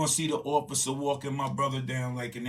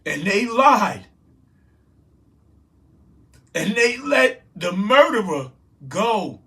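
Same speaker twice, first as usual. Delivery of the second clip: emotional outburst; 5 semitones higher, 11 dB louder than usual. Real, not acted. Grieving brother cries out about the killing in real anguished shouting. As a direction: cry it out in real anguish, pausing between phrases. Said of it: "I'm getting emotional".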